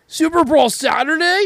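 high, loud promo shout; hyped announcer voice. Retold or imitated. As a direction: hype it up like a wrestling ad. Mimicking Lex Luger on TV.

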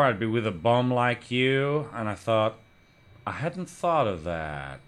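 slow, drawn-out poet's drawl; deadpan slow American poet impression; Charles Bukowski. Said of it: "I'll give you a sentence".

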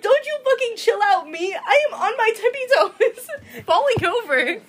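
loud, high, laughing mock outrage; hammed-up indignant shout; mock angry yell. Retelling snapping at tall boyfriend.

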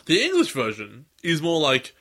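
normal talking voice

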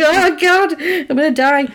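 high-pitched comic panic; hammed-up cry of doom on the run; mock panic. Man running as his collar beeps, mock-panicked.

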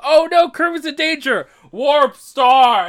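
high, loud mock cry of danger; mock alarm. Mocking the show, a hammed-up panicked cry. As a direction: ham up the panicked call for the star. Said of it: "the episode is like".

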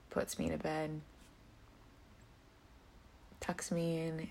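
soft bedtime whisper. Real, not acted. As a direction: breathe it like a lullaby. Bedtime talk, drifting off.

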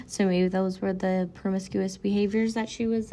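ordinary delivery

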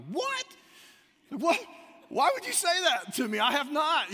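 high, loud imitation of an outraged friend; indignant disbelief. Acting out friend stung by clerk's remark, indignant disbelief. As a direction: squeal it back, totally caught off guard. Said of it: "he's totally getting caught off guard".

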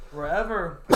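normal talking voice

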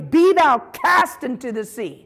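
slow, commanding declaration; speaking with authority. Shown as a demonstration. Preacher commanding a mountain to move, authoritative. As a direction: command it firmly, word by weighty word. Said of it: "we've got to speak with authority".

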